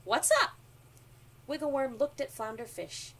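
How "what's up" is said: high, loud question; lively raised-voice hello; shouted greeting. A narrator's reading. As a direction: call it out to a friend. Fish shouting greeting to worm.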